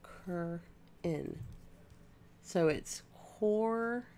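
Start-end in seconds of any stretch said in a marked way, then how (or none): 0.0-1.4 "Cur in" in two separate syllables; sounding out syllables. Shown as a demonstration. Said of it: "Am I saying it right". 3.1-4.1 "core" slowly drawn out; trying out a syllable. Shown as a demonstration. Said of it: "but it's not core".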